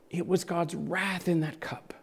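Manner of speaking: hushed, breathy whisper. Real, not acted. Preacher whispering to the congregation about the cup.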